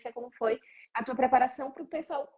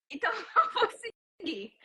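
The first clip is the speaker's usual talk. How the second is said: rindo ao imitar a decisão dele; laughing; 6 semitones higher than usual. Real, not acted. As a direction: laugh through the words. Admira a persistência dele rindo. (rindo de admiração ao contar)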